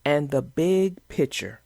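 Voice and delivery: put-on gentle rhyming read-aloud for kids; storybook reading. A narrator's reading. Vlogger reading a bedtime rhyme to kids, storybook voice. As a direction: read the picture book to young viewers. Reads picture book aloud.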